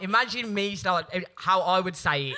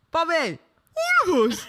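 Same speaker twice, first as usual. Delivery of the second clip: high, louder Brummie catchphrase; Brummie greeting; 12 semitones higher, 6 dB louder than usual. Retold or imitated. Greeting Bobby Beale in the street, high Brummie catchphrase. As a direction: greet him in his own Brummie line. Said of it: "that is what I'd say to him".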